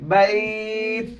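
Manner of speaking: বাইত with a long held glide; pronunciation demo. Shown as a demonstration. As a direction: stretch বাইত before closing on t. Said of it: "এটা কি হবে".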